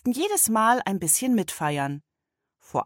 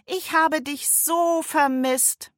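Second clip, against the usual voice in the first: tender; 10 semitones higher, slower than usual. A narrator's reading. Girl hugging her friend.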